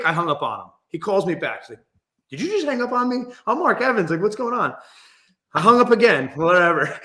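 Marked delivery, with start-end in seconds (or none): 2.3-4.7 high-pitched mock protest; retold indignant disbelief. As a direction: imitate a baffled caller, pitched up. Retold caller protests being hung up on.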